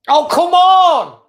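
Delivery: a real fed-up yell at the team; long furious "come on" at the screen; exasperated shout. Real, not acted. Fan shouts at lost control.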